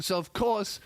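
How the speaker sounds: ordinary talk, nothing marked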